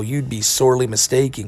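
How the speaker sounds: usual speaking voice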